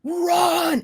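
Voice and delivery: high yelled warning; sudden high warning cry; alarmed shout. Retold or imitated. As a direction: shriek at friends to flee. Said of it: "and she goes".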